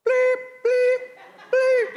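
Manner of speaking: high, loud, drawn-out bleep; imitated censor bleep. Jacob wakes up beside the wrong sister, mock censored cursing. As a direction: hold a piercing mock censor tone.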